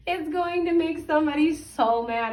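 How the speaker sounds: high, breaking into real laughter; can't hold back a giggle mid-sentence; laughing. Real, not acted. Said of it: "I shouldn't laugh".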